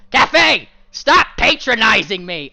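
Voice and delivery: high, loud indignant yell; husband fed up with wife; angry shout. Acted. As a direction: snap at her furiously.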